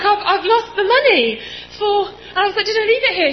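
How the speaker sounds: high, loud, laughing through the panic. Retold or imitated. Telling the shop guy about the lost money, high laughing panic. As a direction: panic you can almost laugh at.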